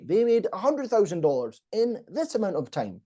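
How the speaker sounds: HUNDRED THOUSAND DOLLARS punched high; emphasized key point. Shown as a demonstration. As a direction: lift "hundred thousand dollars" as the headline figure. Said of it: "any point you say".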